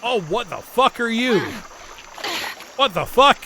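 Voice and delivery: shocked outburst. Real, not acted. Startled by something in game.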